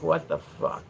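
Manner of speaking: quiet, low mock disbelief; hushed, unimpressed reaction; baffled Irish viewers. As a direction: mutter it like an appalled Irish viewer. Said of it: "people that were from Ireland come and watch".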